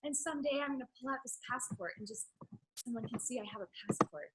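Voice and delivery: small timid voice dreaming quietly; a near-whispered meek wife. Retold or imitated. Voicing the wife, hushed.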